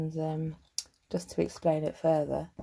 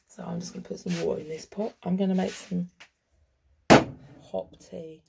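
ordinary talk, nothing marked

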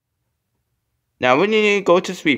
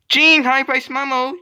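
high, loud pretend hello; excited greeting; 11 semitones higher, 3 dB louder than usual. One toy character greets another at play, excited greeting. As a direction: greet brightly in a raised voice.

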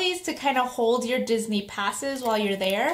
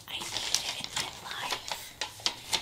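whispering; 10 dB quieter, slower than usual. Real, not acted. Longing for the food.

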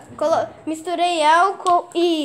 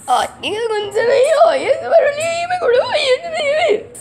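higher, fast, whiny squeaky voice; high whiny creature; 10 semitones higher, 3 dB louder than usual. Pretend. Little creature whining at the noise, high and fast. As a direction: whine like a panicky little creature. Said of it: "a voz do Gutinho".

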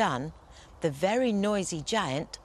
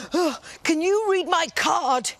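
higher, louder pleading voice; pleading giant; 9 semitones higher, 5 dB louder than usual. Acted. Giant asks the big bad wolf, eager and high. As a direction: ask hopefully, big and eager. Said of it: "he asked the big bad wolf".

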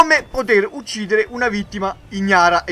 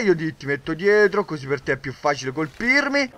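ordinary talk

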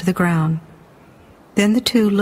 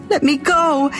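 high, urgent cry; desperate demand; 7 semitones higher than usual. A narrator's reading. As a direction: cry out as you struggle free. Captured girl struggles to break free.

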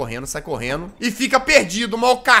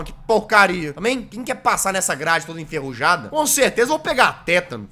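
ordinary talk

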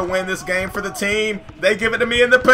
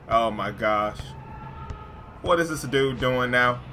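low, soft disbelief; dismayed; 8 semitones lower, 9 dB quieter than usual. Real, not acted. Player watches the mascot score, quietly dismayed. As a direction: say it with all the hype drained out.

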